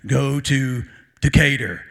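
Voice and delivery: low, loud, slow booming command; voice of God. Pretend. God gives Matt a direct order, mock-divine low voice. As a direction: pretend to be God issuing orders, deep and slow. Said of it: "if God came to me and said".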